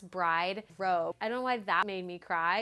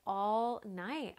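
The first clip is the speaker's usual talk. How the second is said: ordinary talk, nothing marked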